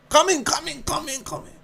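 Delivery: high, soft repeated ushering; mock eager hostess voice; insistent welcoming host. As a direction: chant the invitation high and fading. Acts insistent host ushering him inside.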